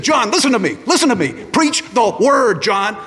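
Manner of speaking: high, loud urgent plea; wild-eyed insistent mentor; urgent charge. Retold or imitated. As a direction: grab him and drive it home. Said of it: "He said, shook me".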